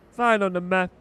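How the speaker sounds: normal talking voice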